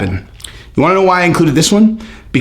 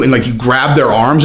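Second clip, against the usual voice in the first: ordinary delivery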